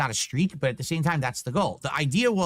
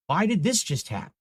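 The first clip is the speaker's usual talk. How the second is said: usual speaking voice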